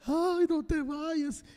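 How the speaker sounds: high, slow mock plea; imitating weeping children. The children at the deathbed, a high drawn-out plea. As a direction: voice the crying kids begging.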